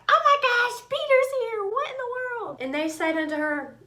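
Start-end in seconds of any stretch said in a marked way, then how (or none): 0.1-2.6 much higher and louder, bursting with news; excited shriek. Acted. Rhoda running in to tell everyone, excited high shriek. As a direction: squeal it like you can't contain yourself.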